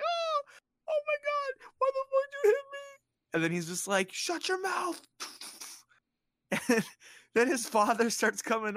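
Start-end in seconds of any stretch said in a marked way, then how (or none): 0.0-3.3 very high, squealing mock cry; hammed-up wailing. Alan wailing after imagined hit. 4.1-5.0 high-pitched irritated order; mock bullying. Bully silencing the wailing kid.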